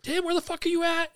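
high, urgent calling out; retold panic. Soldier searching for Tim after the blast, panicked. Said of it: "I panic".

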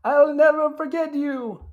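high, slow, hammed-up attempt at a movie accent; mock accent, overdone movie farewell; bad Fabrizio accent. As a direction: draw it out high in a badly faked accent. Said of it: "Fabrizio ruining it with his accent".

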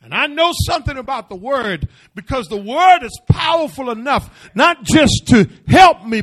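loud, rising preacher's cry; full-force preaching. Acted. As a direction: preach it out to the congregation.

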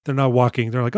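usual speaking voice

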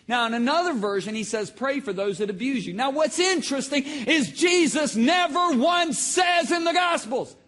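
loud, high preacher shout; full-force preaching. Real, not acted. Preacher making a point about the Gospels, full-force preaching.

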